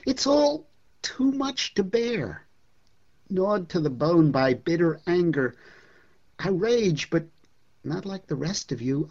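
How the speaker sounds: poem read with mounting rage; dramatic poem reading. Acted. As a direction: perform the poem like a stage piece, rising to a frenzy. Reads Mayakovsky poem aloud dramatically.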